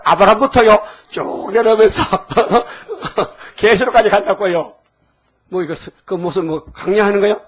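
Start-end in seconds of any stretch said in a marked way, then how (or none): 0.0-4.7 loud amused telling breaking into laughter; tickled, chuckling as you speak; laughing. Real, not acted. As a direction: laugh through the words, pitched up. Mocks a long-winded prayer, laughing.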